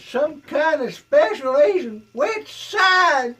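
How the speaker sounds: high, very loud Southern sheriff holler; slow, shouted Deep South lawman accent. Pretend. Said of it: "a deep South Sheriff accent".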